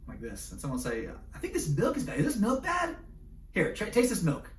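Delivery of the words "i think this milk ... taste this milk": much higher and louder, squeaking up on the question; suspicious milk-sniffer. Acted. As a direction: someone waving the carton at you, urging a taste. Someone suspects the milk.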